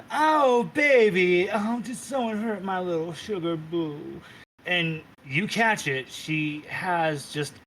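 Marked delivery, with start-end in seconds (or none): 0.1-4.2 put-on high, loud baby-talk coo; doting queen cooing at her husband; cooing Titania. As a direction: gush over him like a pet. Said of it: "You see Titania go".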